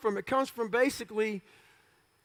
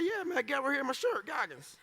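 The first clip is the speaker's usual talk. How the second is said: smart-ass reply; 2 semitones higher, 9 dB quieter than usual. Retold or imitated. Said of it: "Yeah, I'm being a smart ass".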